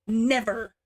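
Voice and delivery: mild spit of a word; spat. A narrator's reading. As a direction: sister refusing with understated venom. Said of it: "Kazunoha spat".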